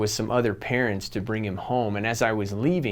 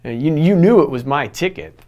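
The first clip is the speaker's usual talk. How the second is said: ordinary talk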